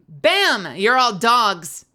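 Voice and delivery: loud triumphant announcement. Real, not acted. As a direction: proclaim it like a victory. Triumphant game moment.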